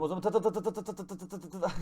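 quick rattling ta-ta-ta bursts; spray imitated shots fast; fast mock gunfire. Can't hit, sprays fire.

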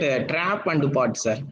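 ordinary talk

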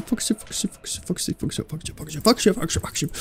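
soft, hushed begging; anxious whispered repetition; whispered pleading. Real, not acted. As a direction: whisper the name over and over. Pleading with the fox animatronic.

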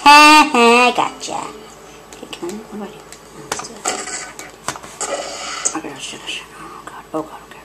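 hushed, frightened whispering; scared whisper. Real, not acted. Sneaking past an enemy, a scared hushed whisper. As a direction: whisper it as if the enemy could hear.

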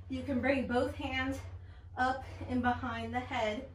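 ordinary talk

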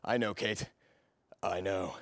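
low, soft seducer's voice; suave flirting. Acted. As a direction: linger on each phrase, low and flirtatious.

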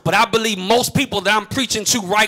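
thankful preaching, building to a shout; fervent, rhythmic sermon cadence. Real, not acted. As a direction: drive each repeated phrase harder toward a praise break. Preaching builds to full force.

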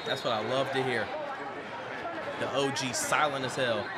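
low, soft gloating; quiet satisfaction. Real, not acted. Viewer enjoying the heckler's silence, quiet satisfaction. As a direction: savour it softly, pitch dropped.

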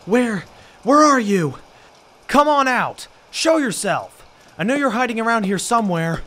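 loud, high shouting into the dark house; calling out. Acted. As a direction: yell for a hidden intruder to come out.

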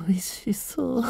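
whispered with relish; hungry whisper. Acted. Vampire whispers over the girl's neck, hungry. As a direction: savor the scent of her blood.

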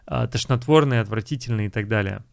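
normal talking voice

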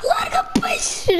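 very high, tearful-sounding squeal; panicked screech. Real, not acted. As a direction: screech at it to let go. Fish grabs the player.

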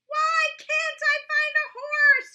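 loud, high frustrated shout. A narrator's reading. Roy shouting after failing to find a horse, loud and high. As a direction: yell it out in despair. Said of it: "he shouted".

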